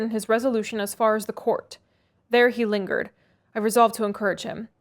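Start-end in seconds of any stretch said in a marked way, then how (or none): none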